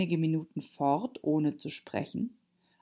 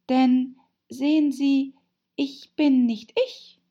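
put-on high, loud girl character; Alice pleading to be understood; earnest Alice; 10 semitones higher, 7 dB louder than usual. A narrator's reading. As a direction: explain emphatically in a high voice.

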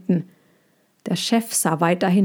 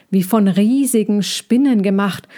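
plain reading voice

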